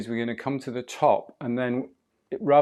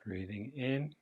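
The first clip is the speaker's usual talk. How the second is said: guided breathing; 8 dB quieter than usual. Acted.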